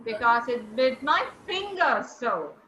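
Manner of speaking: "finger" vurgulu, "so" alçak ve yumuşak düşüyor; stress on finger. Shown as a demonstration. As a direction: land the weight on "finger", let "so" fall away. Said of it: "Stress should be on finger".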